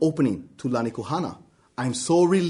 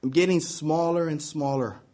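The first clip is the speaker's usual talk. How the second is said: usual speaking voice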